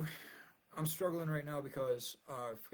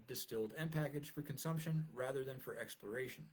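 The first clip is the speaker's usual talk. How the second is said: formal essay reading, a bit higher; reading aloud; 3 semitones higher, 3 dB louder than usual. Acted. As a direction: read it like a lecture paper. Reads his paper aloud.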